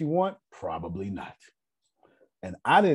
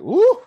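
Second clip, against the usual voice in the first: sudden high, loud whoop; alarmed whoop; 12 dB louder than usual. Retold or imitated. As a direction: mimic a queen's startled woo. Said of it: "And she was like".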